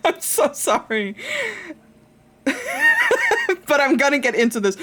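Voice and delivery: high, loud, laughing through the words; fast laughing voice; laughing apology. Real, not acted. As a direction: apologize while still cracking up.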